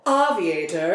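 odd, affected diva pronunciation; Moira Rose voice. Retold or imitated. Actress getting into her weird character voice, affected. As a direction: say "Aviator" with fancy, made-up accent vowels. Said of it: "in the weird Moira Rose voice".

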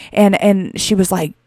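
ordinary talk

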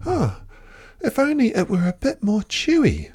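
high Emu voice with a mild sigh; a wistful little sigh. Acted. Emu sighs wishing the stew were chewier. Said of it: "she sighed".

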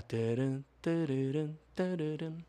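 sung tune of the collect-call jingle; hummed collect-call jingle. Retold or imitated. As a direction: hum the phone jingle, rising. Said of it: "A musiquinha da ligação a cobrar".

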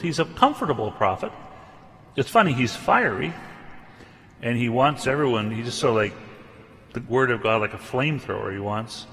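normal talking voice

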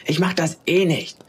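high, half-shouted refusal; mild defiant shout. A narrator's reading. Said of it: "Funkelchen schrie nun regelrecht".

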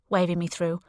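plain reading voice

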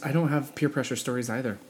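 ordinary talk, nothing marked

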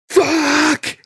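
mock anguished yell. Biker whose motor just blew, yelling in despair. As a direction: howl it like your world just ended.

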